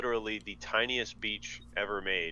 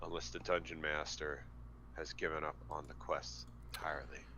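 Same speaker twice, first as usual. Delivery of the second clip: very soft, low, near-whispered tease; hushed aside; 6 semitones lower, 11 dB quieter than usual. Real, not acted. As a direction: drop to a murmur. Teasing aside about silent game master.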